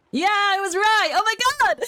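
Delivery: high, loud excited shout; thrilled cry of being right. Real, not acted. Guessed the game right.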